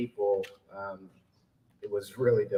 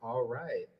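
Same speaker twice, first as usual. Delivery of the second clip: ordinary talk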